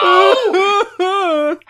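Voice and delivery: high mock wail. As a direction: wail it in fake despair.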